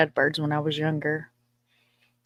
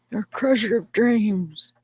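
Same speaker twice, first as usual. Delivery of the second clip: mock reproach; 5 semitones higher, slower than usual. Mock reproach to dream-crushing husband.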